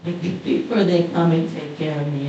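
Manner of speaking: ordinary delivery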